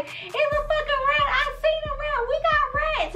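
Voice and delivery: very high, softened squeal of panic; mock hysterical screaming. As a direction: act out screaming at your husband, kept quiet. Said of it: "so I'm screaming".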